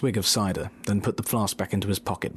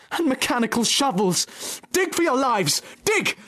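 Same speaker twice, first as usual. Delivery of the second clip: a father fox commanding escape, ever higher; urgent shout. Acted. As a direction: yell to the family to dig. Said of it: "shouted Mister Fox".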